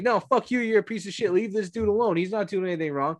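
high-pitched indignant telling-off; retold angry outburst. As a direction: act out angrily defending someone. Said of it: "Like, I got so angry".

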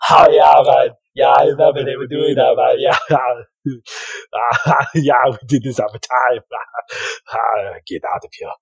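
loud, laughing Jamaican Alpha; Jamaican accent. Acted. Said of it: "you hear Alpha".

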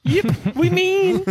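high, playful squeak; squeaky mock voice. Mock confession of meanness.

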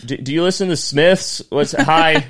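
high, loud, stumbling chat-up; awkward pickup attempt. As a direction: fumble a clumsy opener over the bar noise. Acts out clumsy bar pickup.

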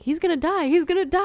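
high, loud mock panic. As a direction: yell it like a scared moviegoer. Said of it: "Sarah was like".

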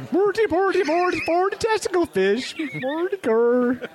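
high, loud mock Swedish gibberish. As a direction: ham up a fake Swedish chef. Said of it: "only in Swedish. So it would have read like".

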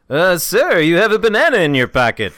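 loud, high-pitched comic impression; high, exaggerated sitcom-comedian voice; Jerry Seinfeld. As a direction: belt it out like a whiny stand-up. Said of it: "So start over with Jerry Seinfeld".

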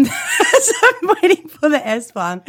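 slow, high, laughing through the words. Real, not acted. Podcaster laughing at her drunk night, giggling.

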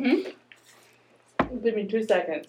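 talking through peanut butter; mouth full. Real, not acted. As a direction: speak around a mouthful. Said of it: "If you don't talk with your mouth full".

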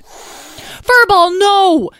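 high, loud alarmed yell; panicked high-pitched shout. A narrator's reading. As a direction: shout to stop a pet. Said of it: "yelled Tom".

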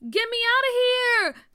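high, loud panicked cry; panicked scream. A narrator's reading. Tia trapped inside the box, panicked scream. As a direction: scream to be let out. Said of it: "Tia screamed".

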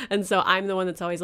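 ordinary talk, nothing marked

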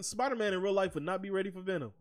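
normal talking voice

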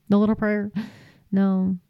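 normal talking voice

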